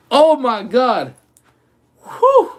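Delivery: breathless and winded; out of breath. Real, not acted. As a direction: gasp it out winded. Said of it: "Let me catch my breath".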